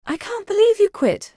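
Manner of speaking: high-pitched, thrilled disbelief; lightly voiced classmate, astonished; amazed classmate. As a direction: squeal it with excitement.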